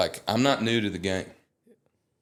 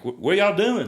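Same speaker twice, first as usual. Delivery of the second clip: [name] impression; 11 semitones higher, 5 dB louder than usual. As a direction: impersonate a laid-back country star greeting fans. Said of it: "Luke was like".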